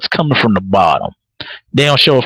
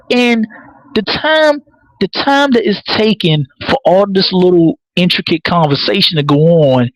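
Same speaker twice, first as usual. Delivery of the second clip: ordinary talk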